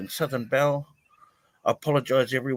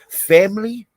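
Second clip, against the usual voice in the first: Family called out high and loud; exclaimed; 10 semitones higher, 10 dB louder than usual. Real, not acted. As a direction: burst out with Family. Exclaims word then repeats it.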